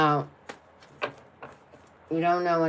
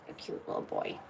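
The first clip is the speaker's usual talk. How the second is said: whispering; 12 dB quieter, faster than usual. Real, not acted. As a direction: breathe it softly to a pet. Cooing to pet.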